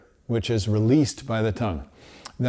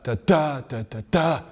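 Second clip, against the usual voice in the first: each "da" punched with a push of air, rising; kicked syllables; 3 semitones higher than usual. Shown as a demonstration. Teacher showing the wrong, kicked articulation, punched. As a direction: hit every "da" hard like a kicked note. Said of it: "This is what trumpet players tend to do".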